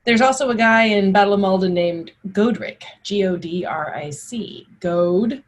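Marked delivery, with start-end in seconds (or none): none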